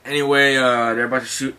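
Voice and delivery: ordinary delivery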